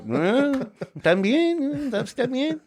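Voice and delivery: put-on high, slow, shrugging Mexican-accented mumble; high slow lazy Mexican accent; mock Mexican accent. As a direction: play the confused old Mexican cop. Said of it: "They're just like".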